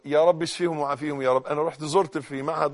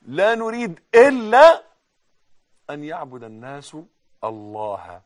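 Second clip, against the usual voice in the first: slow, swelling sermon delivery, ending louder; slow preaching; 8 dB louder, slower than usual. Acted. Preacher to his congregation, slow swelling sermon. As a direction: preach each word slowly, building up.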